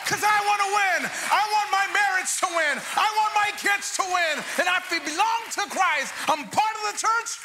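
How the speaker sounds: rising call-and-response shout; preaching at full force. Real, not acted. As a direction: shout it up to the rafters.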